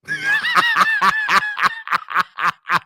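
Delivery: long high cackle; high shrieking laugh. Real, not acted. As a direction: break into a shrill laughing fit. Laughs at being called fool.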